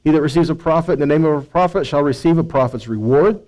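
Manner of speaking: ordinary talk, nothing marked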